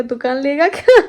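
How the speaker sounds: laughing through a loud tease; laughing retort. Retold or imitated. Teasing the neighbour boy, loud laughing retort. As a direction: tease him while laughing hard.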